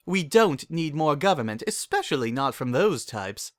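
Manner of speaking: breezy dismissal in a posh-pony voice; Rarity, dismissive. A narrator's reading.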